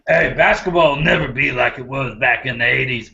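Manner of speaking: put-on a Southern old-timer griping about basketball; southern old man. Host mocks coach as grumpy southern man in southern accent. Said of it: "I just made him, like a southern".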